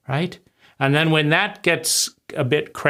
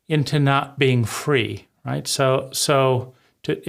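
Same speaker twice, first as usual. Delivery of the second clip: normal talking voice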